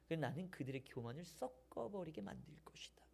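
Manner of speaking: grave hushed whisper; whispered judgment. Acted. God pronouncing judgment on proud Israel, a grave whisper.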